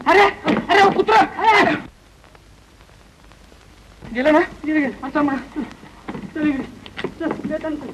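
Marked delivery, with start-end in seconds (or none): 0.1-1.7 shooing a dog. Acted. Shouting to scare a stray dog away. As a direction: chase the dog off with a yell.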